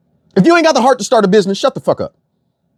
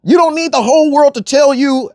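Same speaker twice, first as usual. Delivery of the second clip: usual speaking voice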